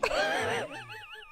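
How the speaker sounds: squeaky high laugh. Real, not acted. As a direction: giggle up high.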